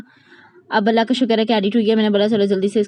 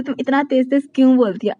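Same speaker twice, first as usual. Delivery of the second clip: quoting people, lightly voiced; 3 semitones higher than usual. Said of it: "अब मुझे इतने सारे लोग बोलते".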